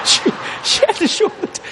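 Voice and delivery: words broken by laughter; laughing. Real, not acted. Grandson to congregation, laughing mid-sentence. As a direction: laugh through it.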